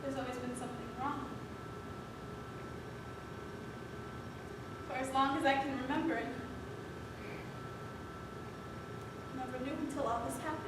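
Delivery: very soft, halting and low; quiet, troubled admission; hushed confession. Acted. Halting confession in a drama scene.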